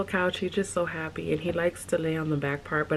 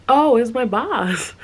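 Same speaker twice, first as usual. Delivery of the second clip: sudden realization; 4 semitones higher, 10 dB louder than usual. Real, not acted. Sudden recall exclamation.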